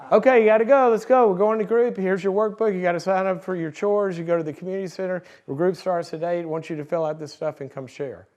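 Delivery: loud, high, fast orders from a bossy nurse; barking nurse. Retold or imitated. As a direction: bark the ward rules like a drill sergeant nurse. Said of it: "Nurse Ratched is at their door".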